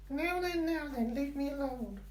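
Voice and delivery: dog voice. Acted. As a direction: answer for the dog in a squeaky voice. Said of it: "you don't normally talk like that".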